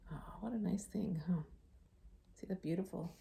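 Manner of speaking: soft whispered aside. Real, not acted. Teacher whispering to the kids about the shell. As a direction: breathe it warmly.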